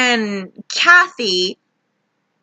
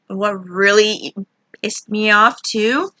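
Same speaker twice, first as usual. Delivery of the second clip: normal talking voice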